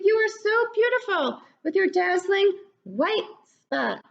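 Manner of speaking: admiring ladybug. Acted. Lucy admiring the caterpillar, high and bright with longing. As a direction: gush over her spots in a high voice. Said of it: "called Lucy".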